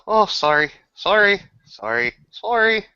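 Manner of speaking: lightly voiced polite apologies, climbing in pitch; apologetic Canadians. Canadian kin apologizing to each other after a dispute, lightly mock-apologetic. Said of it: "So there's a lot of".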